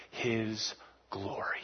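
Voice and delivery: slow hushed whisper. Real, not acted. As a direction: breathe the words in awe. Sermon climax whispered.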